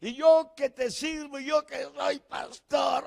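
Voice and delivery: high, protesting cry of "why"; mock anguished protest to God; imitating his own complaint. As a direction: pastor replaying his bitter complaint, high-pitched. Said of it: "en silicio delante de Dios".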